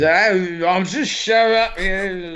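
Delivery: put-on slow, dazed drunk mumble; slurred drunk bloke, slow and dazed; drunk impression. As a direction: play a bleary pub drunk. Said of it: "That impression is the per".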